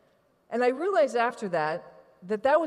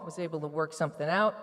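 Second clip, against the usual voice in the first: normal talking voice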